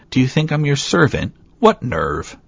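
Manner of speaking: high scornful outrage; affronted sneer; indignant huff. Acted. Indignant stepsister.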